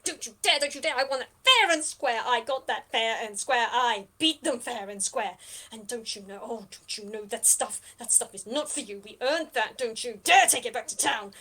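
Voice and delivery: angry protest with loud bursts; getting angrier. Acted. Captive Julianne fights for her loot, getting angrier. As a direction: insist furiously on your claim. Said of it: "she is just getting angrier and angrier".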